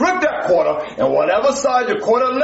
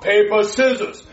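normal talking voice

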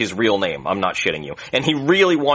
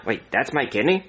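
normal talking voice

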